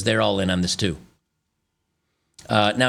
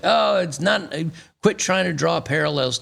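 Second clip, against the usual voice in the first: high, scoffing mock voice; mocking critics; 8 semitones higher than usual. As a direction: toss it off like a dismissive critic. Mocking critics who dismiss parallels.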